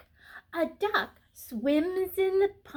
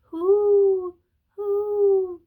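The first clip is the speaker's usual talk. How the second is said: higher, louder hoo like a bird; pigeon coo; 5 semitones higher, 9 dB louder than usual. Acted. Reading to children, a loud pigeon coo. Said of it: "The pigeon says".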